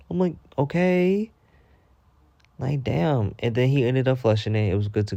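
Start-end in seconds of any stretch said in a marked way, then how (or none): 0.6-1.3 dubious okay. Retold or imitated. Dubious reaction to the man.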